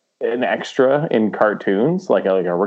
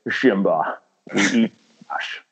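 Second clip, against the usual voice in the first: slow Scottish Mufasa with SH for S; mock-solemn Scottish lion father; Scottish accent, slushy S sounds; Sean Connery; 2 semitones lower, slower than usual. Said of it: "how Sean Connery was lined up".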